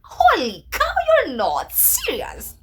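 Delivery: very high, loud disbelief, then slow; astonished shout. Retold or imitated. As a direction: shriek in joyful disbelief. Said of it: "And I'm like".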